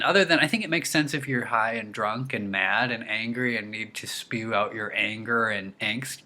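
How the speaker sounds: ordinary delivery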